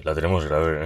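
teasing a friend with his dad's low voice; mock deep dad voice. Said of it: "y hace".

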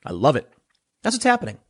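ordinary delivery

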